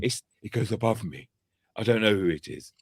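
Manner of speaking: lightly put-on sultry woman's voice, brushing him off; a hint of a sultry telephone voice, passing the buck. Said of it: "you got a right telephone sex voice".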